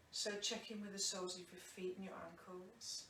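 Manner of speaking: softly whispered cue. Acted. Teacher whispering a body scan cue to the resting class, hushed. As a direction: drop to a gentle whisper to guide.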